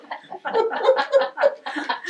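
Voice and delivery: long high falling laugh. Real, not acted. Laughing at her own story to the interviewer. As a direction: burst out laughing. Recalling funny memory.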